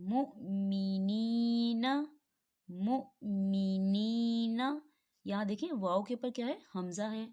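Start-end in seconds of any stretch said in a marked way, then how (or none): 0.1-4.8 मुमिनीना chanted very slowly in recitation voice; मुमिनीना in slow Quran recitation tone; Quranic recitation. Shown as a demonstration. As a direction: recite मुमिनीना slowly, joining मीम to हमज़ा. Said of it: "हमज़ा की मिसाल को देखें".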